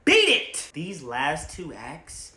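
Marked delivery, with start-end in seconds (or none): 0.0-0.7 high, loud bark; quoted shout; shouted movie line. Retold or imitated. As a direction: yell it at them.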